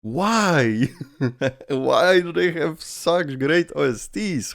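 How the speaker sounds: normal talking voice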